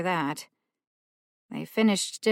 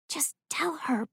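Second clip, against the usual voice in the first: whisper; 10 semitones higher, 4 dB quieter than usual. Acted. Rowan whispering plea.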